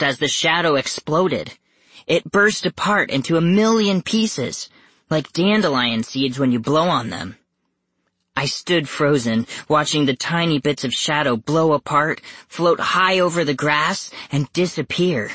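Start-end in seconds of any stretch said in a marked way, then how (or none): none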